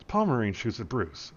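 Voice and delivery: normal talking voice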